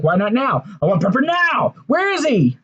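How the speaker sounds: retold outburst, high-pitched and insistent; impatient demand. Wrestler demands his dog back from his rival, impatient, high-pitched mock demand.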